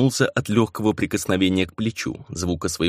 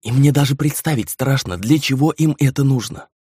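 put-on high, frightened young woman's voice; anxious, near-panic plea; panicked; 5 semitones higher than usual. A narrator's reading. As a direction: confess your dread with pitch raised. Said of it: "застыла реальная паника".

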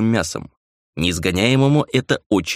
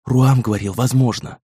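put-on raised, anxious young woman's voice; frightened, higher-pitched reply; audible fear; 3 semitones higher than usual. A narrator's reading. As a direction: insist on it with fear creeping in. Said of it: "В голосе девушки зазвучал явственный страх".

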